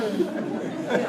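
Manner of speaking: ordinary talk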